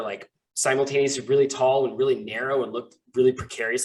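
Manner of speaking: usual speaking voice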